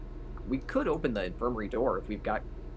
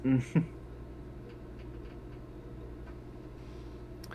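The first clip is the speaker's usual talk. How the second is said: a quiet airy laugh; soft breathy laugh; 3 semitones higher, 7 dB quieter than usual. Real, not acted. Player laughing at his own in-character reaction. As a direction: let a short laugh slip out.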